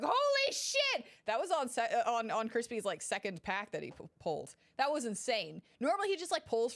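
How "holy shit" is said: very high, slow shriek of joy; high drawn-out squeal; retold scream. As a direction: relive the car scream. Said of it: "We were like".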